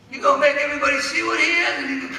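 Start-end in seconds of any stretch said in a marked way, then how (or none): none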